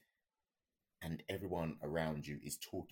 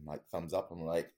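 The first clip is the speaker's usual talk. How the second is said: ordinary talk, nothing marked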